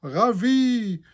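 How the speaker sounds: loud, high hearty Santa laugh; jolly ho ho ho. A narrator's reading. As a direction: boom it out delighted. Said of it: "Il s'exclame".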